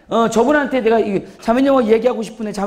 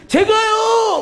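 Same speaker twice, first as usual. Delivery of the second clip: high, drawn-out 'me!'; eager volunteer; 11 semitones higher, much slower than usual. Acted. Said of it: "적극적이에요". (적극적으로 나서는 높은 외침)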